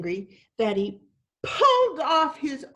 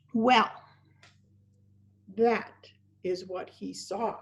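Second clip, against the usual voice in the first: plain reading voice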